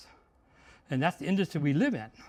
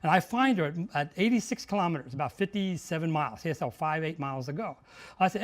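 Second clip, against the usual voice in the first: usual speaking voice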